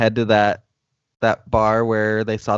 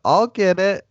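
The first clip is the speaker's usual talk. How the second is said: raised-pitch mock yell; retold yell; 9 semitones higher, 3 dB louder than usual. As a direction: call it out high, imitating her. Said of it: "she yells out".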